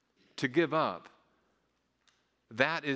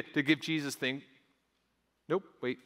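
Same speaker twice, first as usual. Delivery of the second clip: normal talking voice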